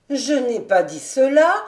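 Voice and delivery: put-on old servant cries out in protest to Jude; crying out. A narrator's reading. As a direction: blurt it out in protest. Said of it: "s'écria Gothon".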